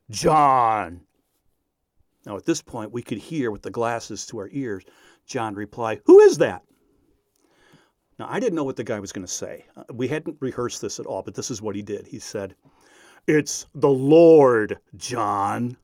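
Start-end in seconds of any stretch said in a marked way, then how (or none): 0.1-1.0 low, drawn-out mock-divine call; deep bass voice. Prankster calls John again by radio, deep bass voice. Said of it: "bass voice into the microphone". 6.0-6.6 high, loud startled shout; startled call. Retold or imitated. Pranked student answers mystery voice on radio, startled shout. 13.3-15.8 slow, booming mock voice of God; deep bass voice. Prankster poses as the Lord on radio, deep bass voice. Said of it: "with his deep bass voice".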